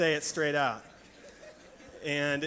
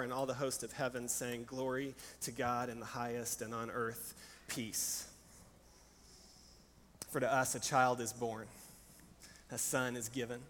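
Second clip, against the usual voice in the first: hushed scripture storytelling; 2 semitones lower than usual. A narrator's reading. Retells the gospel story hushed.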